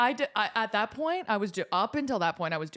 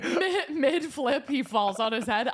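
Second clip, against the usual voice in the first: laughing; 5 semitones higher, slower than usual. Real, not acted. Laughing mid story.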